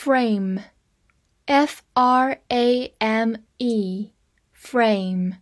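slow letter-by-letter spelling; teacher spelling frame slowly. Shown as a demonstration. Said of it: "A发字母本身的音A".

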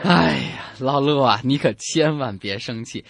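normal talking voice

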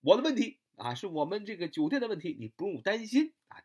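lightly acted clerk reassuring a guest; good front desk clerk. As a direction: step up eagerly to own the problem. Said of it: "你一定要说是谁的问题".

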